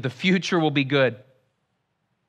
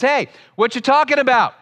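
higher, lightly acted casual butting-in; casual interrupting Jesus; 7 semitones higher, 4 dB louder than usual. Jesus comes alongside two men talking, casual mock interruption. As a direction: pipe up as a friendly passer-by cutting in.

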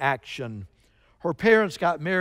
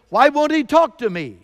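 high, loud retold demand; 8 semitones higher, 8 dB louder than usual. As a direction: cry it out as a confused wife pleading. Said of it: "she just demands".

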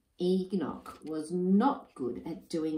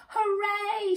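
much higher cheer; joyful shout; 3 dB louder than usual. Acted. New father cheering as his chicks hatch, excited. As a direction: shout for joy. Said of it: "shouted Enoch".

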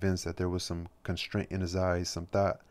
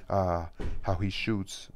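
tipsy cry of delight; much slower than usual. Acted. Margarita, getting drunk, cries out to the master, slow and delighted. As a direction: savour each word as she cries out. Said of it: "Margarita cried".

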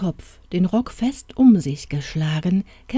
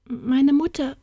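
put-on frightened boy; 7 semitones higher than usual. A narrator's reading. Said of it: "war alles, was der Knabe hervorbringen konnte".

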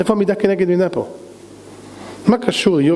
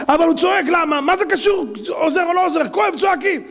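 high, insistent protest; exasperated, hammering argument; heated rebuttal; 12 semitones higher than usual. Acted. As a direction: argue back in a high raised pitch. Argues back at Job's excuse.

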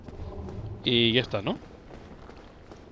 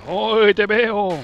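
burla cantarina; 8 semitones higher, 5 dB louder, much slower than usual. Pretend. Ve al enemigo escondido.

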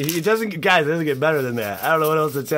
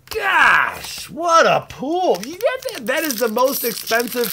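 thrilled; 12 semitones higher, 4 dB louder than usual. Real, not acted. Showing the camera the best foil, high and thrilled. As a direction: squeal at the pull. Reacting to most expensive foil.

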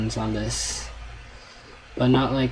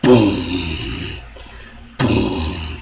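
low, loud drawn-out boom; imitated boom; 4 semitones lower, 4 dB louder than usual. Gamer echoing the scary game, low booms. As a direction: voice a deep thudding boom. Imitating game's booming sound.

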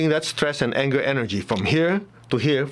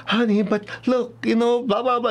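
mock parent trying to reason, pitched up; pleading parent; 10 semitones higher than usual. Parent explaining to an upset child, high mock coaxing.

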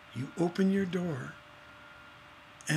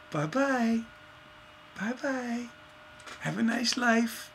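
soft, high singsong farewell; breezy dismissal; 6 semitones higher, 5 dB quieter than usual. Acted. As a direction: wave them off in a put-on high voice. Cheerily dismissing the ousted critic.

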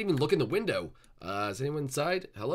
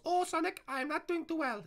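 very high thin falsetto; feeble hurt Tails. Pretend. Tails tells Sonic he is hurt, high and pitiful. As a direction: play the injured little sidekick.

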